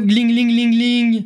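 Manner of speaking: cash register jingle. Acted.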